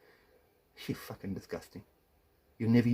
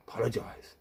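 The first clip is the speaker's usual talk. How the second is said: contemptuous whisper; 4 dB quieter than usual. Real, not acted. Contemptuous order to ex-wife.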